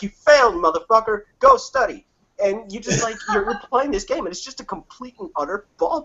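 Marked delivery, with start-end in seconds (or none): 0.0-2.1 loud and much higher, barking the verdict; hammed-up teacher shout, pitch jumping up on go study; mock yelling teacher. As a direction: yell it down at the student like a fed-up teacher. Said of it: "And they're like".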